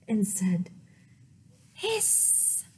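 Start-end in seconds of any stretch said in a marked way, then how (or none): 1.7-2.7 drawn-out snake hiss. Acted. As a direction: hiss like a snake to scare Square. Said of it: "just like a snake".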